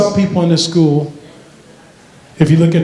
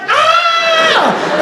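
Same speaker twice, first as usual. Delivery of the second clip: very high, held shriek; mock girl's scream. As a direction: squeal like a panicked schoolgirl. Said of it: "The girl was like".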